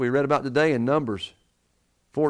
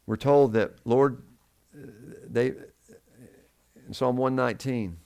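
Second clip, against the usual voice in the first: normal talking voice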